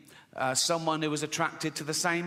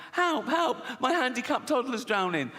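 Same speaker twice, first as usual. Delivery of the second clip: high-pitched mock cry for help; panicked mother; 8 semitones higher than usual. Woman running up on a beach, hammed-up panic. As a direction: plead urgently as a frantic mum. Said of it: "a woman came running up and went".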